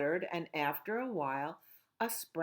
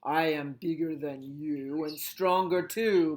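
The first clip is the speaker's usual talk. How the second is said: a hint of a boastful ghost, a touch louder; boasting ghost; 4 dB louder than usual. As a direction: brag like a proud little ghost. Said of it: "boasted the ghost".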